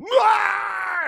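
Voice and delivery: high, loud throat-shredding scream; hammed-up raw yell; imitated scream. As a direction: scream till the voice box strips. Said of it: "scream until his voice box sounded like".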